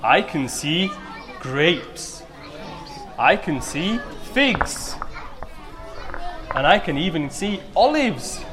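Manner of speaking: put-on bright, upbeat talk to children; kids show host. Host asks children questions.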